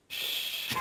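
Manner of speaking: unvoiced-breath shush, kept low; soft, discreet "shh"; hushing. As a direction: shush someone to keep a secret. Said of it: "he didn't wanna be, like, recognized".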